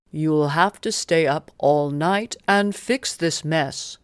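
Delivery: a stern king telling off his helper; annoyed Oberon. A narrator's reading. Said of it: "Oberon is mad at Puck".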